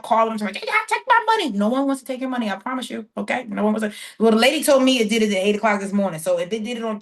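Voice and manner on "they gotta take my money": high-pitched panicked caller, hammed up; mock panic in a high squeal; mock freak-out. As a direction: mimic someone freaking out, voice shooting way up. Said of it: "don't be freaking out".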